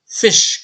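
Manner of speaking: fish with a short crisp i, loud and high. Shown as a demonstration. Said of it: "le I court". (fish avec un i court, net et fort)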